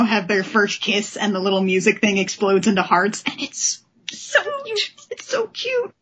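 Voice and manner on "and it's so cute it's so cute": very high, squealed gush; squealing cute. Real, not acted. Host to co-hosts over the kiss page, high squeal. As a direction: squeal it like a fangirl.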